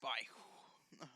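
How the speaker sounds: hushed whisper. Acted. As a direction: whisper the question. John whispering a question.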